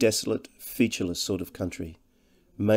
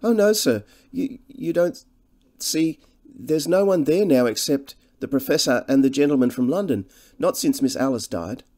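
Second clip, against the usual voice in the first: higher-pitched, deferential countrywoman; humbly fretful; 6 semitones higher, 4 dB louder than usual. A narrator's reading. Cottage woman worrying over Harry being out late, humbly fretful. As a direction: fret humbly in a raised woman's voice. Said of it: "She spoke in a humbly fretful voice".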